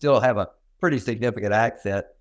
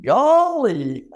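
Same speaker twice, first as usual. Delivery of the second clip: Gomer Pyle; 13 semitones higher, 4 dB louder than usual. Retold or imitated. Guest echoing the host's Gomer Pyle, high and loud. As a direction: sing it out like the TV country boy. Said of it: "the reference to Gomer Pyle".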